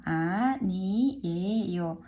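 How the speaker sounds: low voiced model. Shown as a demonstration. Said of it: "one more time".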